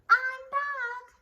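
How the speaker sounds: high loud cheerful greeting; sweet teacher voice. A narrator's reading. Returning teacher greeting class, high cheerful. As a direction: chirp it brightly. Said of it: "she said".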